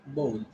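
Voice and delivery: "bowl" with an O sound, falling; correct bowl. Shown as a demonstration. Teacher repeating for students, bowl with O sound. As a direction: say "bowl" like bole. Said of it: "The O sound you'll get".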